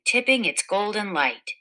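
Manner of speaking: ordinary delivery, nothing marked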